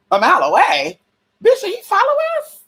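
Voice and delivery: shrieking high alarm; shocked. Retold or imitated. Realizing the stalker is a mile away, shocked high shriek. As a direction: jump way up in pitch, alarmed.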